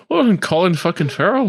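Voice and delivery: high, mock Irish accent; Colin Farrell. Teasing impression of the actor, high mock Irish accent. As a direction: lilt it in a badly faked Irish brogue. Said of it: "I'm Irish".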